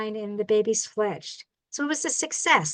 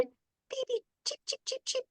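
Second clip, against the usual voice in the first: tiny high peeping chicks, much higher and softer; baby bird cheeps; 13 semitones higher, 10 dB quieter than usual. Pretend. Baby birds startled by pebbles, high soft cheeping. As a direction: squeak like startled nestlings.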